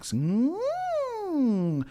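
high hummed siren glide; vocal siren. Shown as a demonstration. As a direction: hum a rising-falling siren. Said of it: "What a siren is".

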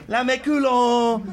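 put-on chanting Spanish in a French accent. Teasing a bumlick in Spanish, drawn-out French accent. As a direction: draw it out high and loud in mock French. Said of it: "doing it in a French accent".

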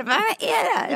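high, loud startled question; alarmed what is that; shocked shriek. Retold or imitated. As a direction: squeal in disbelief. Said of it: "Och jag bara".

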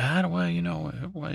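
high-pitched, halting spider reply; hesitant spider. Acted. Voices the spider's unsure reply.

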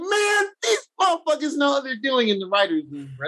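high, amazed praise of the writers; excited fan gushing; awed excitement. Retold or imitated. Awed fan reaction in theater.

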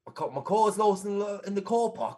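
put-on London accent with broad vowels. Shown as a demonstration. Co-host repeating host's line on request, London accent. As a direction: attempt a London "car park". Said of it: "Give us a London accent".

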